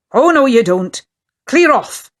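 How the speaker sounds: loud, rising angry shout; angry growl. A narrator's reading. The sailor drives the children away, loud angry growl. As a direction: chase the children off the gate. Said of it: "he growled".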